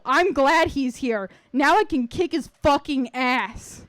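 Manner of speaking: slowed, seething threat; slow menacing anger. Acted. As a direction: spell out the threat slowly, with venom. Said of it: "and she flipped".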